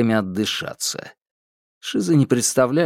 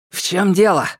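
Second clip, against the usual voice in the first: breathless question while running; out of breath; 8 semitones higher, 3 dB louder than usual. Acted. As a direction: ask it gasping for air. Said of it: "задыхаясь, спросил Минхо". (задыхаясь на бегу)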